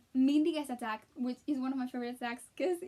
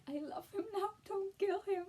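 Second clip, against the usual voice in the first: higher, soft, tear-choked begging; tearful plea; 7 semitones higher, 4 dB quieter than usual. Retold or imitated. Fan begging the film to spare Chad, tearful. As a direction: beg the movie through tears. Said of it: "I was hurting so bad".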